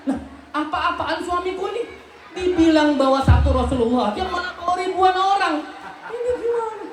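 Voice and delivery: a wife watching a crowd arrive, baffled; exasperated wife. Acted.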